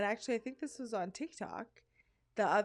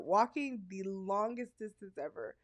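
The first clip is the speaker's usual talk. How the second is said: ordinary talk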